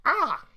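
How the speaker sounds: sharp, high, loud yelp of pain. Acted. Halfling reacts to a paper cut, startled pained yelp. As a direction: cry out briefly at a sting.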